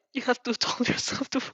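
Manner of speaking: plain reading voice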